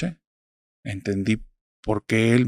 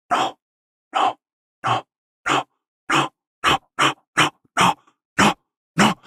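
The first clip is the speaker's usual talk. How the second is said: eerie voices; 10 semitones higher, slower than usual. Retold or imitated. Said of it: "como si mil voces me hubieran hablado".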